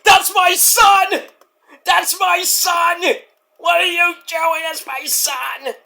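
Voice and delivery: high, drawn-out mock screaming. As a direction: wail it slowly, fading at the end.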